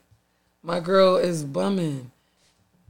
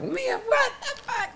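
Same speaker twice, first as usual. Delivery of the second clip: laughing; 4 dB quieter than usual. Retold or imitated. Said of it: "people gonna be like".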